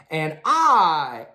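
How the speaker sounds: ordinary delivery, nothing marked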